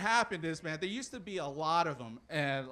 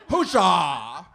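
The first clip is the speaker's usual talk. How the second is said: loud, rousing medieval cry; hammed-up battle cheer; mock herald's cheer; 12 dB louder than usual. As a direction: cheer for the knight at full volume. Herald's cheer ending introduction.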